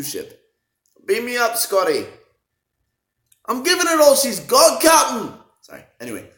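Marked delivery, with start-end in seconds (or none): 1.1-2.1 higher, louder hammy captain's order; playful mock command; movie quote call. 3.5-5.4 much higher, much louder strained put-on engineer; mock frantic engineer; hammed engineer voice.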